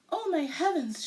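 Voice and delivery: mother crying out at the stripes; alarmed cry. A narrator's reading. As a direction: gasp it out in shock. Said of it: "she cried".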